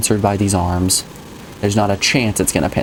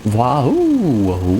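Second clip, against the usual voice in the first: cartoon cheer; 3 semitones higher than usual.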